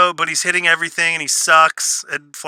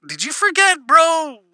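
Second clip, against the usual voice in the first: incredulous; 12 semitones higher than usual. Retold or imitated. Mock questioning the character.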